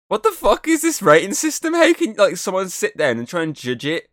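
very high-pitched, baffled outcry; incredulous, squeaky-high questioning; exasperated disbelief. Acted. Exasperated rant at rating system.

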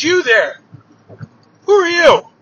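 startled giant calling out a challenge; giant's challenge. Acted. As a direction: call out sharply, pitch jumping up. Waking giant challenges a stranger.

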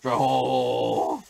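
long-held, drawn-out call, lightly hammed up. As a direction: stretch the name out slowly as a mock entrance. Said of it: "자, 보여드릴게요". (형 흉내 내며 길게 부르기)

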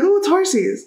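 high, sing-song cooing over a horse; cutesy baby talk. Retold or imitated. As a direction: coo "horsies" like a delighted child. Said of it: "I'm like".

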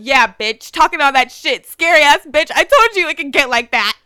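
loud, punchy trash talk; mock-angry taunt. Reading fight texts to her friend as a loud taunt. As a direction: act out a quarrel, hitting each phrase hard. Acting out a text quarrel.

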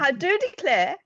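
mock Southern belle; Southern US accent. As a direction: playfully put on a Southern American accent. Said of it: "a really strong Southern American accent".

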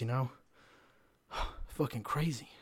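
ordinary delivery

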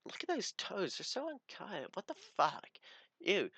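high, disgusted mock girl voice; mock snooty girl. Imitating the girl mocking his toes in jandals, high and disgusted. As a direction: sneer it like a bratty girl. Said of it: "she was just like".

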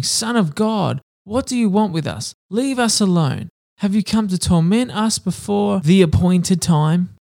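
a hint of tormented demons begging; demons screaming.

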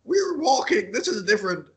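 high-pitched mock exasperation; exasperated retort. Retelling an argument with dad about walks, high exasperated retort. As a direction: insist on it, high and hammed-up.

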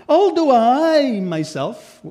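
slow, high and loud on Although I myself; emphatic reading. Shown as a demonstration. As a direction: lean hard on Although I myself. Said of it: "it's very emphatic".

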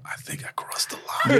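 hushed embarrassed whisper; breathy aside, almost silent. Real, not acted. Whispered aside after awkward moment.

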